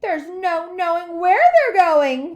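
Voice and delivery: high, loud, drawn-out whoop; gleeful cry. A narrator's reading. Wonka in the dark tunnel, crying out gleefully. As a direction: hoot it out with wild glee. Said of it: "cried Mister Wonka, hooting with laughter".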